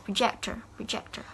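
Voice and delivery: "projector" said pro-JEC-tor; stress on middle syllable. Shown as a demonstration. Teacher modelling the related word, stressed "proJECtor". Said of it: "it's same with projector".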